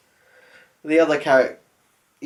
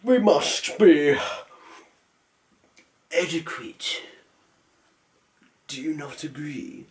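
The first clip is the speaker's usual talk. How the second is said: classy manner; 2 semitones higher than usual. Acted. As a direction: put on a classy voice, taking your time. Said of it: "classy way".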